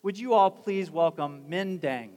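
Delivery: normal talking voice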